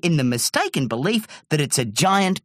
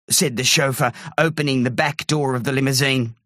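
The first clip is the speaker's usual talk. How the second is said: ordinary delivery, nothing marked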